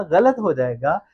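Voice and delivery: normal talking voice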